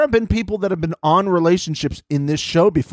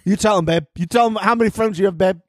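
mock babe cheer; 6 semitones higher, faster than usual.

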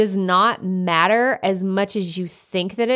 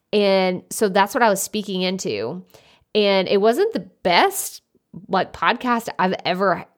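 normal talking voice

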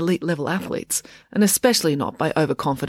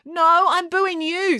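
slow, very high-pitched jeer; drawn-out high heckler's cry; heckling; 13 semitones higher, much slower than usual. A narrator's reading. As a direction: call out a retort from the crowd. Heckler calls at the speaker.

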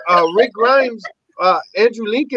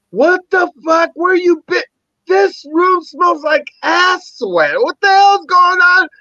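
high-pitched mock outburst of a wife; outraged wife; 11 semitones higher, slower than usual. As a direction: act out her disgusted demand, high and drawn-out. Wife storming in, retold.

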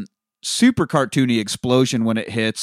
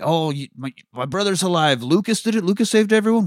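overjoyed; 8 semitones higher than usual. Retold or imitated. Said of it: "the brother's like".

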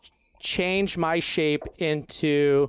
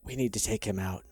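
low, soft conspiratorial whisper; plotting murder in a murmur; 8 semitones lower, 8 dB quieter than usual. Acted. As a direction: lean in and whisper the plan. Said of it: "whisper, like".

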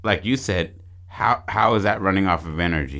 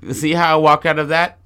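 high, loud cartoon koala showing off; pitched-up, punchy talking-animal boast; koala bear mascot; 8 semitones higher, 6 dB louder than usual. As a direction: crow it as the triumphant koala. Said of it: "the koala bear comes down like".

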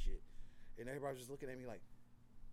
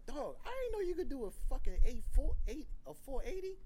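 high, loud amazed buddy; hyped-up friend; 11 semitones higher, 11 dB louder than usual. Retold or imitated. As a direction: rave about the trick, thrilled. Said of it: "Like, bro".

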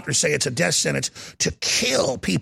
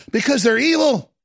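ordinary talk, nothing marked

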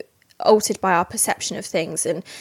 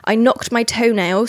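ordinary talk

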